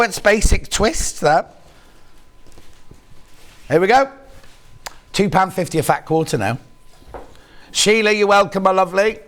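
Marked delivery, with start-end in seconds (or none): none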